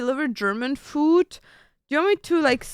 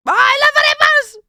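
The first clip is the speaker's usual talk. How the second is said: very high, loud squeal of love; over-the-top excited outburst; excited shout; 10 dB louder than usual. Real, not acted. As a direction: shriek it with sudden delight. Outburst over a favourite food.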